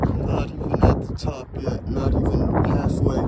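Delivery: winded from the climb; out of breath. Real, not acted. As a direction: talk while hauling yourself up. Climbing to the top.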